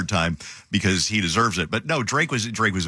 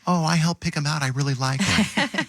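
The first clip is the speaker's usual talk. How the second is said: imitating Jenny; 7 semitones higher than usual. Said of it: "And she'll be like".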